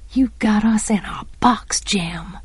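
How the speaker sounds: soft, near-whispered grumble; muttered. A narrator's reading. Scout complaining to Jem in the yard, muttered. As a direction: grumble under your breath. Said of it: "I muttered".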